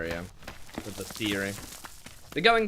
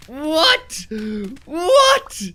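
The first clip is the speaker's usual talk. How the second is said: excited shriek; 12 semitones higher, 12 dB louder than usual. Real, not acted. More treasure found, shrieking.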